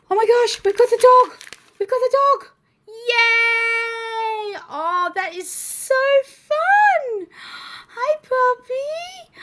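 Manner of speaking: high, loud squeals of joy; excited squealing. Real, not acted. Greeting the new dog toy, high excited squeals. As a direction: squeal over the new puppy. Finally gets the dog figure.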